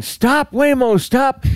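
high-pitched imitation of panicked passengers yelling; mock yelling. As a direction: call it out high, without real volume. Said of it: "were sitting there yelling".

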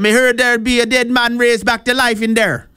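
hammed-up Jamaican accent, pitched high; high, badly faked Jamaican lilt; terrible Jamaican accent. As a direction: put on a bad Jamaican accent. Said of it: "That was a terrible accent".

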